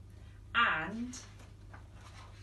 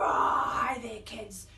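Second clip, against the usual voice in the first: low, loud growly lion greeting; 6 semitones lower, 4 dB louder, slower than usual. Acted. Lion says hi to the kids, loud and low. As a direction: greet them like a big lion.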